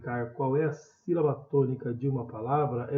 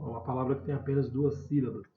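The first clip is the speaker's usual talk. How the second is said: normal talking voice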